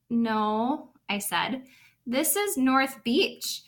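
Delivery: put-on lively storybook narration for little kids; animated read-aloud; reading to children. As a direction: read it like story time in class. Teacher reads picture book aloud.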